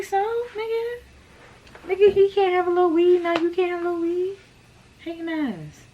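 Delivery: high-pitched mock disbelief; incredulous retort. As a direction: scoff at her, way up high. Her retort to friend retold.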